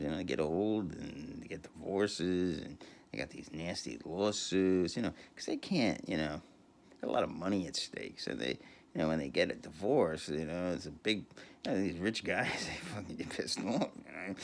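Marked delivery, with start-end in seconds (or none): none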